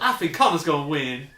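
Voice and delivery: loud laughing take on [name]; [name] impression. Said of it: "she sounded like, like a redneck".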